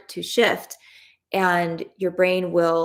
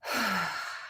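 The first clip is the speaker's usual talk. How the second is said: long sigh; 9 dB quieter than usual. Real, not acted. After "take a breath.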